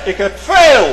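loud, high boastful shout; preacher mocking Esau's loud brag; Esau boasting. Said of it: "Esau roemde".

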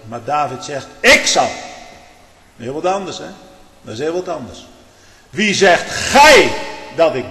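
impassioned Dutch preaching at full volume; full-force preaching. Acted. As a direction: preach it out, lifting the congregation.

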